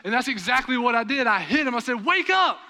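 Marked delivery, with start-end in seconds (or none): 2.0-2.6 much higher, loud shout with a laugh; a sudden yell at the nodding-off driver; shouting wake up. Retold or imitated. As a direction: jolt the sleepy driver awake, half laughing. Hitting a dozing driver.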